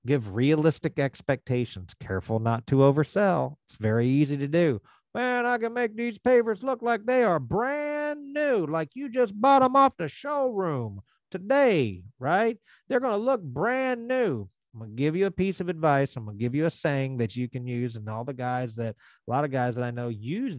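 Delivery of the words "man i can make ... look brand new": high, hyped-up pitch to the customer; overselling salesman. Retold or imitated. Contractor overselling a homeowner, high and hyped. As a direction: gush like a pushy salesman promising miracles.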